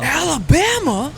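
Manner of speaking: Alabama twang swooping up into a question; mock Alabama accent. Echoing the guessed accent's origin.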